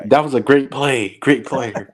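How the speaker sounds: slow, high mock commentator voice. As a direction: drag out a bland TV compliment. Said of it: "That's exactly how it sounded".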